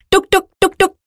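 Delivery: chugging tuk tuk engine noise; engine sound. Shown as a demonstration. Narrator imitating the rickshaw chugging along. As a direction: putter like a little rickshaw engine. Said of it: "this is how he sounds".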